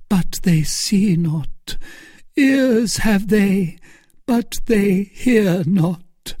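slow, high, grief-laden voice; grieving. A narrator's reading. Milady lamenting to Felton, slow and grieving. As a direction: let the grief drag each word. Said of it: "with an accent of indescribable grief".

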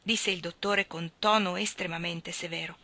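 ordinary delivery, nothing marked

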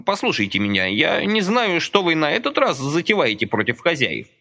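put-on high pleading old man's voice; fretful old man. A narrator's reading. Old Socratych begs Roma to stop, high fretful pleading. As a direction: warn him anxiously, hands pressed as in prayer.

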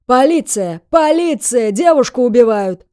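loud call for police; loud yelling. A narrator's reading. Bystander raising the alarm for the girl, yelling. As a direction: holler the alarm for all to hear. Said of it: "а потом громко закричал".

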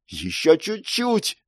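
slow, high, strained call; urgent cry. Acted. Girl calls to Mark mid-ritual, urgent cry. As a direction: cry out to keep him going. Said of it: "крикнула она мне".

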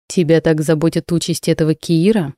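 usual speaking voice

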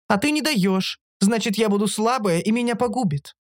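high-pitched, insistent creature; creature Оша reasoning. A narrator's reading. Оша explaining why she needs brains, insistent.

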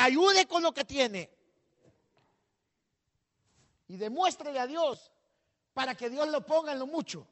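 predicando a todo pulmón, fuerte y agudo; predicación encendida. Acted. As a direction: clamar con fervor ante la congregación. Preaches to the congregation. (predicador que exhorta a la congregación con fuerza)

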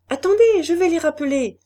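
high, loud call to wait; crying out. A narrator's reading. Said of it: "Charlotte s'écria". (s'écrier vivement, voix haute et forte)

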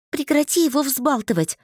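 high-pitched sharp order; commanding. A narrator's reading. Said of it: "скомандовала я".

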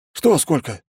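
high, baffled, feigned confusion; desperately playing dumb. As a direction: play dumb, cornered. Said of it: "переспросил отчаянно тупящий секретарь".

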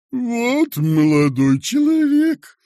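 high, loud, drawn-out complaint; bitter, humbled lament. A narrator's reading. Humiliated boss complaining to visitor, bitter lament. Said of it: "горько усмехнувшись".

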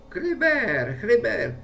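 high, loud call to the ferret; calling again. A narrator's reading. Le maître appelle encore son furet, voix haute et forte. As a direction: call out the name, ringing through the garden. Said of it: "Et il appela encore".

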